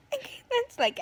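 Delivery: usual speaking voice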